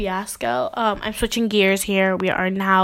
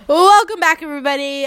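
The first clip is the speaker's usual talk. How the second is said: big mock host welcome, high and loud then settling; show host; 6 semitones higher, 6 dB louder than usual. Host welcomes pretend audience after action, show-host voice. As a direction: sing out the show's welcome.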